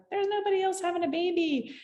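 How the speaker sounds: high-pitched rush that slows on the last plea; mock labor protest. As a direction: rattle it off like a mother in labor pleading for permission. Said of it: "I was like".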